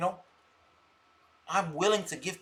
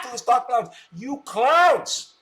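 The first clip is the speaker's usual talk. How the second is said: taunting; 6 semitones higher, 6 dB louder, slower than usual. Real, not acted. Taunting the viewers after laughing.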